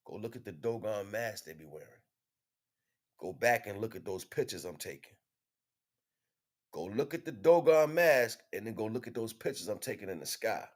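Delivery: normal talking voice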